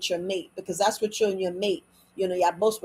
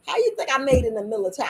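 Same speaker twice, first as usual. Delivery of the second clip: ordinary talk, nothing marked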